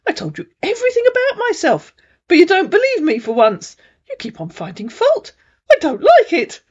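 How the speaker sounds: high, fast, tearful protest; high crying voice of the scammer; hurt scammer. A narrator's reading. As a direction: plead like a wounded man near tears. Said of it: "he said".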